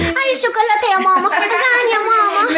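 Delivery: put-on childish high whine for chocolate; whiny child. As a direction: beg mama like a hungry small boy. Small child begging mother.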